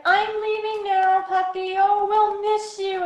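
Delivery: high, loud, slow gushing goodbye to a puppy; overexcited goodbye. Shown as a demonstration. Owner fussing over leaving the dog, gushing and high. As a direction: ham up a big fuss over leaving. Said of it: "If you start getting all excited".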